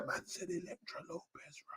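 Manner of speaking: unvoiced hushed whisper. Real, not acted. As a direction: lean in and whisper it. Whisper after cheer.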